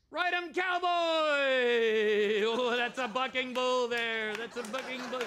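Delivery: loud, high, drawn-out yell; rodeo announcer. Acted.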